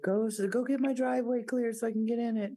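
retold instructing. Parent retelling a call to the son, urging. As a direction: act out sending someone on an errand.

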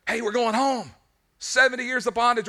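high-pitched, jubilant announcement; excited. Acted. Freed captive announcing the return, excited. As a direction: burst out with the good news. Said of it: "what's all the excitement about".

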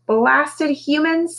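squawky high parrot, slow and annoyed; high, drawn-out bird grumble; grumpy parrot voice. A narrator's reading. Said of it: "said a voice".

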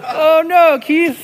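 high, loud retold reply to a neighbor; mock reassuring call. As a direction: call it out with exaggerated volume. Answering the outraged neighbor.

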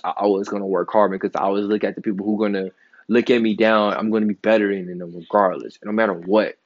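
ordinary talk, nothing marked

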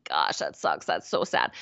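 low, deflated sigh of a mourner; mopey grief. Retold or imitated. Left behind after a death, low and glum. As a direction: let it sink low and glum. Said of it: "we're left behind thinking".

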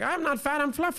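high-pitched playful punchline voice, imitating Fluffy; Gabriel Iglesias. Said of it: "He's like".

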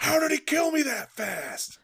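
high, loud outraged shout; raging gamer. Acted. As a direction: yell in disbelief at the screen. Acting out the raging victim.

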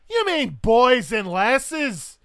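high, booming Scottish woman; loudly and slowly put him right; Highland wife. Acted. Wife corrects her husband from behind, booming Scottish challenge. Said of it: "And she'll say".

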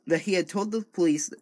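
ordinary talk, nothing marked

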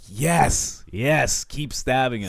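excited cheering. Retold or imitated. Viewer cheering the stabbing, excited and loud. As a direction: cheer like a fan at the screen.

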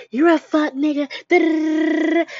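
high, mocking insult in a put-on voice. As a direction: mimic the ranting ex-girlfriend she refused to be. Mock of arguing with ex.

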